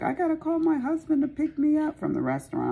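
high, fast put-on voice of a tipsy sister. Sister who had a few sips of a martini, high and hurried. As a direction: play the sister, flustered after one sip. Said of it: "And she was like".

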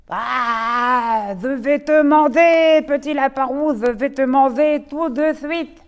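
booming menacing wolf; loud wolf threat. Acted. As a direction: bellow the threat. Wolf threatens second rabbit. (loup qui menace le lapin roux)

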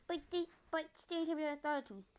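high-pitched mock protest from a viewer; imagined objecting viewer. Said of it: "but if you're like".

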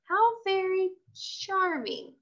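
high, slow, prim remark; dry. A narrator's reading. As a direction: drawl it out with polite disdain.

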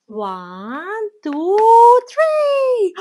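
building count, rising to a squeal; excited countdown. Acted. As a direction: count up to the big reveal. Counting up to the reveal.

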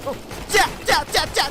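normal talking voice

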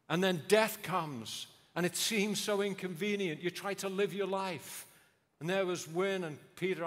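preaching at full force. Acted. Preacher at funeral, loud impassioned preaching. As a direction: press the point home from the pulpit.

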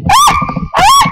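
sudden high-pitched squeal of fright. Real, not acted. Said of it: "I always do girl screamings".

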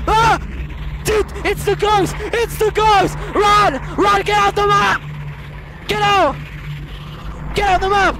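high frantic shouts; panicked yelling. Real, not acted. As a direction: scream to get away from the ghost. Fleeing ghost in game.

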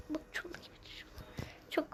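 unvoiced whisper. Real, not acted. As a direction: whisper it under your breath. Sudden whisper.

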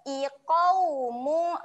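slow chanted "Iqaumu"; Quran recitation. Shown as a demonstration. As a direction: draw the word out in recitation. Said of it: "Okey, seterusnya".

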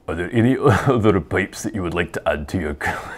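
put-on Scottish accent collapsing into laughter; failing Scottish accent. Host reading a pipe question, crumbling Scottish accent with laughter. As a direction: attempt a Scottish accent and lose it midway. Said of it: "it's totally falling apart".

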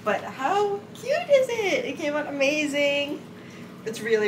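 high, drawn-out gushing; delighted gushing. Real, not acted. Gushes over the finished cardigan.

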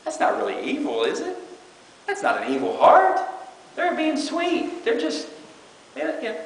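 high, sugary, lightly mocking; mock sweet voice. Preacher mocking a sweet doubter, a hint of sweetness. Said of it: "they can say it with a nice voice".

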